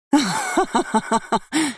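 high, shrill cackle of a woman losing control; unhinged high-pitched cackle; hysterical laugh. Acted. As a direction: burst into wild mad laughter. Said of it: "истерично захохотала баронесса".

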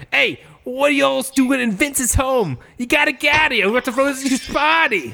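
high-pitched, loudmouthed buddy character voice; Turtle impression. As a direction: call it out in a high, put-on character voice. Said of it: "he talks like turtle".

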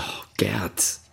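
soft, weary whisper of a name; tired whisper. Acted. Martin whispering wearily to the drunk Gerd.

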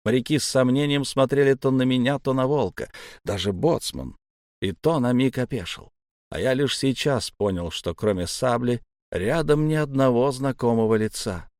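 plain reading voice

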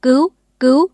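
loud, high desperate cry; panicked shout for rescue; cries for help. Acted. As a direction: scream out for help from afar.

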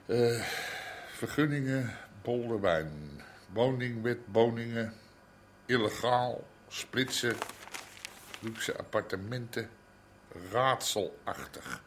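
mumbling keywords while skimming the paper, soft and low; muttering while reading. Acted. Reads newspaper headlines under breath.

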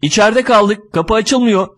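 high-pitched, panicky complaint; a woman's shrieking, acted without full volume; mock screaming. A narrator's reading. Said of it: "Kadın çığlık çığlığa".

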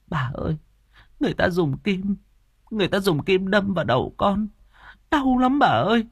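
put-on high-pitched pleading girl; high, distressed wail; frightened, in pain. A narrator's reading. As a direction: cry out to granny in fear and pain. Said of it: "run lên cầm cập".

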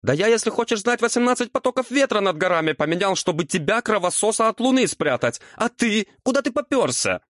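very high-pitched outraged rant; aggrieved devil working himself up; indignant tirade. A narrator's reading. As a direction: reel off your grievance high and hurried.

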